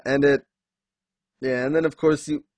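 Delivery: ordinary talk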